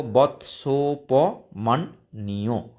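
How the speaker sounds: বৎসোপমন্যু drawn out very slowly, syllable by syllable; very slow Sanskrit recitation of বৎসোপমন্যু; very slow split pronunciation. Shown as a demonstration. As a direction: stretch বৎসোপমন্যু out slowly for the students. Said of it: "এইভাবে উচ্চারণ হবে".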